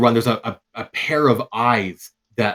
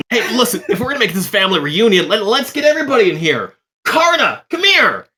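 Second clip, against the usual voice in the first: very high, fast, louder calling out; eager rallying call; 13 semitones higher, 8 dB louder, faster than usual. Acted. Zolt calling Karna over for the reunion, very high and eager. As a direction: wave everyone in excitedly. NPC rounding everyone up.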